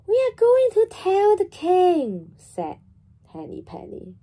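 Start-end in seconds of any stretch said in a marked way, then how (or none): 0.1-2.3 eager hen. Acted. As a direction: chime in keenly like a hen. Hen voiced in children's story.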